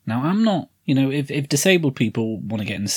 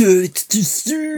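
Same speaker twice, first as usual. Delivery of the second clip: mock villain voice; 9 semitones higher, slower than usual.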